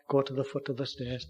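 normal talking voice

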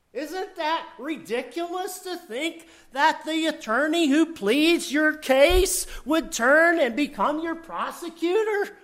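high, scoffing disbelief; incredulous. Acted. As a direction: make the idea sound absurd. Scoffing at an absurd idea.

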